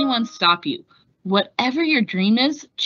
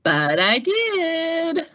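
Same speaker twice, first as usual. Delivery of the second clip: slow, higher, proud triumph; triumphant; 9 semitones higher, much slower than usual. Acted. Amelia proving the magazine wrong, slow triumph. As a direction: let the victory ring.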